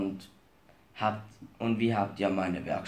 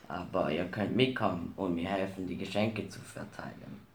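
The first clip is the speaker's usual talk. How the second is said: ordinary delivery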